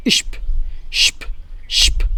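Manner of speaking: श्प as hushed "sh" closing on "p"; "shp" sound. Shown as a demonstration. Teacher shows how German sp sounds, श्प as "shp". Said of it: "इसका sound निकलता है".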